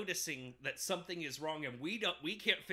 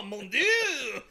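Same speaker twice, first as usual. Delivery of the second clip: high, loud, laughing French shout; joking about a French movie, loud mock French cry; mock French exclamation; 10 semitones higher, 15 dB louder than usual. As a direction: bellow it like a cartoon Frenchman. Mocking the French film.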